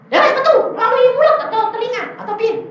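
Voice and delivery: high, loud impatient questioning; re-enacted exasperated retort; acted challenge. Challenging the demon on its exit.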